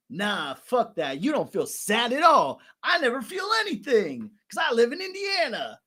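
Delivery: high, loud mock outburst; King Zig impression. Host playing King Zig answering Mimic's sadness, hammed-up rapper yell. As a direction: build into an over-the-top defiant holler. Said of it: "And King Zig's like".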